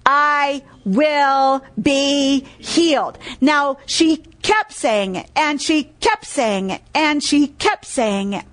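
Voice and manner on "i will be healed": very slow, one word at a time; deliberate declaration. Retold or imitated. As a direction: declare it slowly, weighting every word. Preacher declaring the verse with emphasis.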